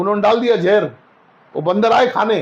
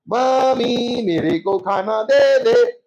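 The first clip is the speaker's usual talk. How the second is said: slow sing-song; much slower than usual. Retold or imitated. Boy speaks in rhythm at home.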